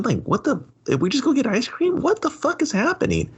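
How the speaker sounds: high-pitched exasperated disbelief; incredulous outburst. Real, not acted. Podcast host ranting at a senseless movie scene, incredulous. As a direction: let the pitch shoot up in baffled protest. Incredulous rant about the film's plot.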